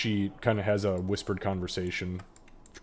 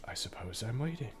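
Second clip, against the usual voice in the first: dazed mumble; 10 dB quieter than usual. Acted. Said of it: "you just hear him go".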